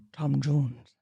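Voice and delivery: low-pitched Welsh accent demo. Shown as a demonstration. Podcaster demonstrating her warm-up phrase, Welsh accent. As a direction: drop down into a Welsh voice. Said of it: "I'm doing a Welsh accent".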